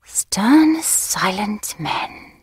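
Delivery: dreamy whisper to herself, slowing on silent men; ecstatic murmur. Acted. Daughter dreaming of Rhodesia, rapt whisper. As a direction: breathe it like a secret romantic dream. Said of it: "I murmured to myself in an ecstasy".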